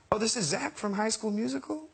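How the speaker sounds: high, light impression of a pop star; lilting high voice, rising into a question. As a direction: imitate him, delighted at recognizing someone. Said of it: "And he goes".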